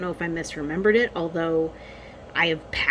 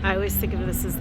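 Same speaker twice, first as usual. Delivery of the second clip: ordinary talk, nothing marked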